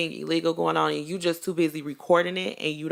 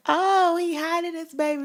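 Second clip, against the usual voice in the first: mocking gossip voice; 12 semitones higher than usual. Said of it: "people already was like".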